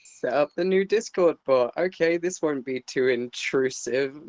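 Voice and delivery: ordinary delivery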